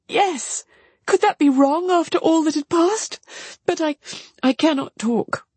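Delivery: put-on high, overwrought young woman's voice; high plea, halting at the end; distraught sister. A narrator's reading. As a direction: defend yourself, then break off. Marianne breaks off, overcome.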